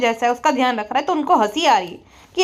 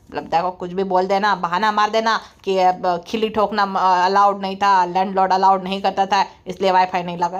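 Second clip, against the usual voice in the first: low, droning put-on man's voice; mock deep voice; 6 semitones lower than usual. Mocked husband tells partner to credit his recharges, low smug voice. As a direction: drop the voice low and lecture smugly.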